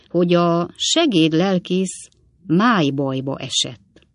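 slow, hesitant and careful; slowly. Acted. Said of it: "amikor lassan így szólt".

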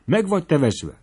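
startled angry rebuke; angry outburst. A narrator's reading. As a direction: lash out at him in alarm. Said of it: "támadt rá Homlódiné".

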